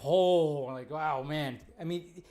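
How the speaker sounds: ordinary talk, nothing marked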